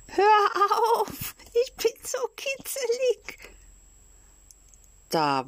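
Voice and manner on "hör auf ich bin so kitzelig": very high squeaky giggly voice; a ticklish little wooden voice, squealing; tiny ticklish squeal with a laugh; giggling tiny voice. Acted. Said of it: "kichert's und lacht's".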